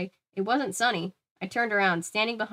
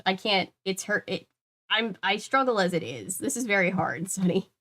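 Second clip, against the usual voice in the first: normal talking voice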